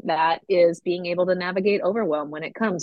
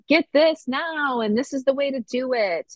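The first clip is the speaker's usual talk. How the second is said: mock sales pitch; 7 semitones higher than usual. Host mocking new year diet ads in a mock salesman voice. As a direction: draw out the pitch like a hyped promo.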